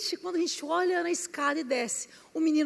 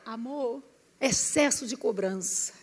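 soft retort; 5 semitones lower, 6 dB quieter than usual. Retold or imitated.

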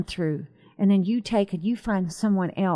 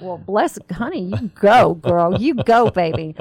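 cheering her on; 7 dB louder than usual. Retold or imitated. Cheering on a girl.

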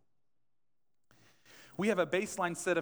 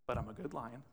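lion's voice; 5 semitones lower, 8 dB quieter than usual. Retold or imitated. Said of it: "He says".

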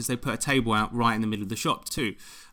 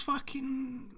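whisper; 11 semitones higher, 12 dB quieter, much slower than usual. Real, not acted. Disbelieving aside about the boy.

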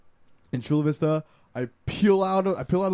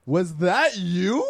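slow dawning realization; 3 semitones higher, much slower than usual. Retold or imitated. Friend realizes.

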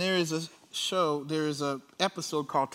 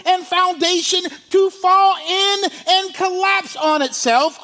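loud, high-pitched sermon delivery; full-force preaching; 10 semitones higher, 10 dB louder than usual. Real, not acted. Preacher declaring God's judgment on evil, full force. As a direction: drive it out loud, stretching the key words.

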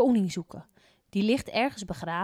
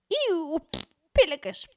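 disgusted; 9 semitones higher, 5 dB louder, slower than usual. A narrator's reading. Mouth full of sand.

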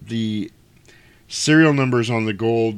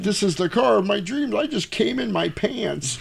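mock swoon over a dream car; higher awed gushing; 11 semitones higher than usual. Man gushing over a car in a high voice. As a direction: gush like a man in love with a car. Voicing character in awe.

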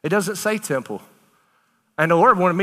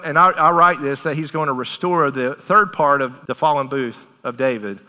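normal talking voice

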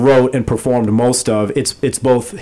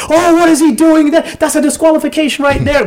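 high excited announcer voice; play-by-play commentator; 13 semitones higher than usual. Retold or imitated. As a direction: call it like a shocked commentator. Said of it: "the play-by-play guy was like".

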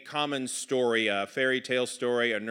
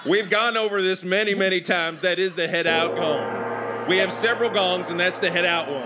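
higher, impatient Southern dad voice; exasperated Southern father; 6 semitones higher, 6 dB louder than usual. Acted. Father explains the gongs to forgetful son, exasperated Southern voice. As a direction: explain it wearily in a Southern accent.

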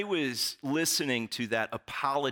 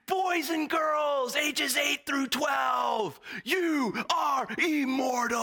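much higher and louder, wild drawn-out yelling; frenzied hyped shout; 3 dB louder than usual. Retold or imitated. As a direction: lose all control and shout at the kids. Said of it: "and he's like".

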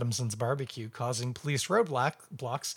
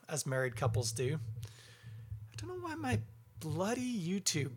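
usual speaking voice

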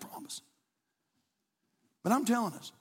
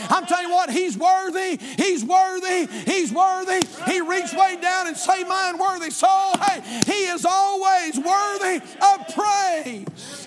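loud, rising preacher's cry with slow, drawn-out worthy; full-force preaching; 8 semitones higher, 5 dB louder, slower than usual. Acted. Pastor rousing the congregation in full preaching voice. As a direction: shout it to the whole church.

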